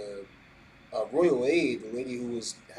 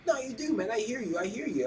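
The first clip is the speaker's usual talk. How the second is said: high-pitched, insistent mock protest; playful insistence; 11 semitones higher than usual. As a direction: push back, acting out someone who won't take no. Acting out an insistent questioner.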